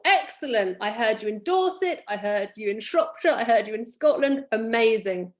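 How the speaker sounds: ordinary delivery, nothing marked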